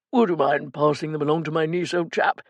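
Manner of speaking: lightly posh theatrical gent; a hint of a plummy actor. A narrator's reading. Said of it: "in his best posh actor voice".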